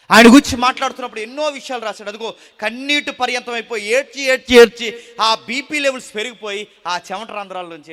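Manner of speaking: loud, high, fervent preaching; full-force preaching. Acted. Preacher thundering to the congregation about fear, loud and fervent. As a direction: drive the point home to the congregation.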